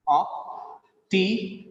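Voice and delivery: very slow "optician", op, TI, shun; syllable by syllable. Shown as a demonstration. Teacher counting syllables, "optician" split into op, TI, shun. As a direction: break "optician" into three separate beats. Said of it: "Here three syllables are there".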